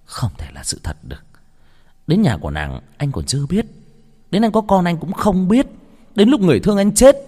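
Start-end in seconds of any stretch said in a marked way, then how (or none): 0.1-1.2 low, hushed near-whisper; soft, shocked denial; stunned disbelief. A narrator's reading. Narrator voices stunned disbelief. 4.3-7.2 high, louder impassioned narration; pained, voice pushed upward; anguished outburst. A narrator's reading. Anguished narration of what he missed.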